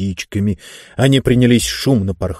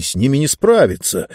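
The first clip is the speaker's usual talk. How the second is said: ordinary delivery, nothing marked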